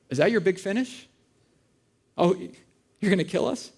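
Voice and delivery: usual speaking voice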